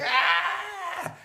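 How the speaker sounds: a single high mock wail, like a baby waking alone; imitating baby scream. Baby wakes to find dad gone, mock high scream. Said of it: "they start screaming".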